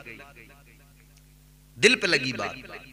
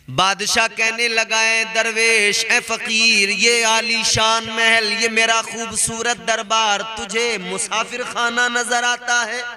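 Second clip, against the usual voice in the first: slow, raised sermon delivery; preaching storyteller; 6 semitones higher, 6 dB louder, slower than usual. Acted. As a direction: build the tale like a preacher from the pulpit. Preacher tells story with force.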